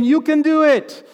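mock pep talk. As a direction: cheer them on like a coach. Mock cheerleading coach.

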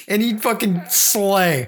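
usual speaking voice

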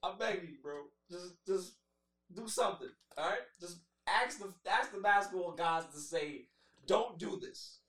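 soft, pleading voice to the gods; quiet begging. Real, not acted. Begging the basketball gods to stop the trade, soft pleading. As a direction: beg softly, almost under breath.

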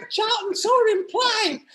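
very high, loud falsetto mum calling her husband; hammed-up piercing falsetto call; Dame Edna. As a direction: shriek it across the house. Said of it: "I know I sound like Dame Edna".

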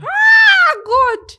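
high, loud shocked cry; shocked exclamation. Real, not acted. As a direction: gasp it out in disbelief. Shocked reaction to story.

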